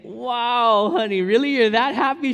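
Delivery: high, loud astonished question; mock amazed husband. Husband replays his surprised reply to wife. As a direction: marvel at her loudly. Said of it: "I'm like".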